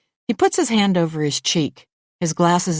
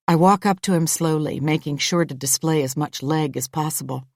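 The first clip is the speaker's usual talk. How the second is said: ordinary delivery, nothing marked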